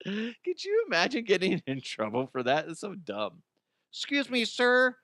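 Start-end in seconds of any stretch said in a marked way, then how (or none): 3.9-5.0 polite officer. Retold or imitated.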